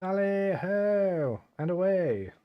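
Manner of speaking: drawn-out high tally-ho call; hunting holler. Pretend. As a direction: sing it out slowly like a fox hunter. Heading off into the mine.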